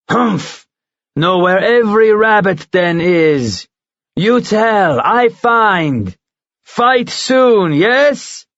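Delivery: boast, then push for the fight; proud giant eagle. Acted.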